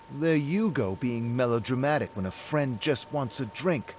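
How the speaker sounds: loud, high, biting echo; heated retort. Acted. David echoes Rick's phrase back at him, loud and biting. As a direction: top his friend's jab.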